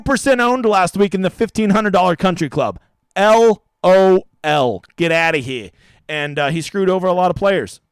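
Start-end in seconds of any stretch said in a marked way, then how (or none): 3.2-5.7 slow, louder spelled-out scoff; mocking. Mocking last week's flop, a slow loud scoff. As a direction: draw out the letters in mock disbelief.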